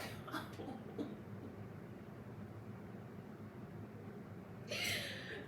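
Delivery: long, high, wheezy laugh; helpless laughter. Real, not acted. Laughing at the daughter insulting her mother, real helpless laughter. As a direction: crack up until the sound nearly disappears.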